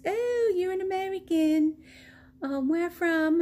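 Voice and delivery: playing the cheerful helper who spotted an American; chirpy helper lady. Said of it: "And she goes".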